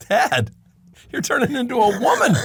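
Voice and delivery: high, loud comic disbelief; hammed-up incredulous outburst; mock exasperation. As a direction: blurt it out as an appalled son. Son's shocked reply to his dad.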